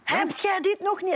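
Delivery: high-pitched, emphatic mock yell; mock shouting in capitals. Texting a boyfriend who should notice the shouting, mock yell. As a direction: say it as if typed in caps. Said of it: "dan hoop ik dat hij doorheeft dat ik roep".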